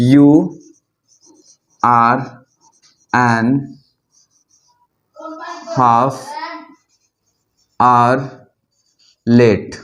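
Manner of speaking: drawn-out dictation of "an half hour"; slow dictation. Shown as a demonstration. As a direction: dictate the wrong sentence word by word. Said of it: "ऐसे ही इसको नहीं लिख सकते हैं".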